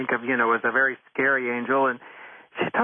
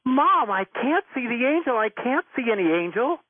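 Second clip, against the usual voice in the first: frustrated daughter; 8 semitones higher than usual. Retold or imitated. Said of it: "the daughter goes".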